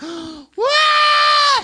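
long, high, loud wail; imitated scream. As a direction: let out a sudden piercing scream. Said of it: "let out this scream".

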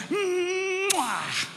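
smack a huge kiss on the fish; exaggerated mwah of a kiss; big smacking kiss. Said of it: "the biggest kiss".

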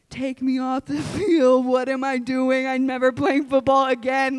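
low, monotone voice of defeat; mortified player. Acted. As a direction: act out a player who wants to disappear. Player mortified after wrong-way run.

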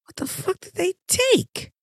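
high, soft, incredulous squeak; baffled question. Retold or imitated. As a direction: ask it in a thin, high disbelieving squeak. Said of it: "I'm looking and I'm like".

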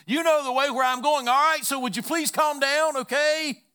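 Jesus raising his voice over the disciples' commotion, exasperated. Retold or imitated. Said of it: "He says".

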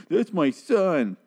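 high, slow, doting plea; mock tender parent. Parent pleading for zombie son, high and slow. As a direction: coo it like a devoted parent. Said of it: "people would be like".

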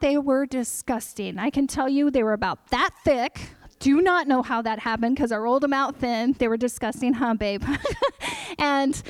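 usual speaking voice

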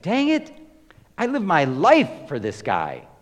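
imitating a furious older brother, loud and high; spitting mad. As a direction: rant like the jealous son who's had enough. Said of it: "He's spitting mad".

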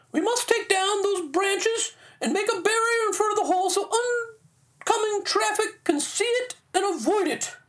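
very high falsetto British lady giving orders; hammed-up proper British wife; formal British accent. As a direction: instruct in a put-on, high British woman's voice. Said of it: "His wife instructed him".

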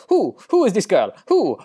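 high-pitched mock Italian outburst; bad Italian accent. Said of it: "in her bad Italian accent".

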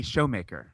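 "Showmaker" with first syllable like "show"; mispronounced surname. Retold or imitated. Posing as someone getting a co-host's name wrong, "Showmaker" with long O. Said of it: "you would correct somebody if they said".

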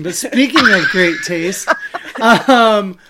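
said again through a laugh. Shown as a demonstration. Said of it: "how this is gonna work as a transition".

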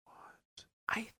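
hushed, voiceless whisper to a partner; frightened whisper. Acted. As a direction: whisper it, very softly and warily. Couple whispering, hearing something outside at night.

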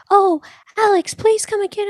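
high, bright mock club owner; owner's voice. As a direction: squeak it out like a sweet host.

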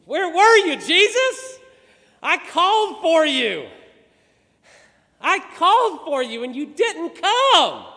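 loud, high-pitched wail of accusation; shouted grieving complaint, full force; anguished reproach. Acted. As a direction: cry out in hurt and protest. Martha reproaches Jesus on arrival.

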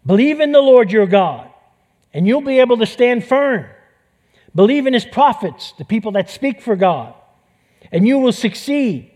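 king preaching belief to his army; fired-up exhortation. Acted. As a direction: urge them on with fire.

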